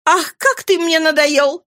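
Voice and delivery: high, drawn-out exasperation; impatient cry. A narrator's reading. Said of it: "крикнул он на нас с нетерпением".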